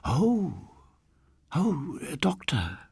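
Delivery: put-on high, fluttery old woman's voice trailing off; lightly voiced relieved old dear; flustered old lady. A narrator's reading. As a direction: soften as you realize he's a doctor.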